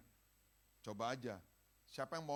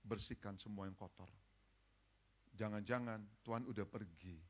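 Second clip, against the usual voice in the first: ordinary talk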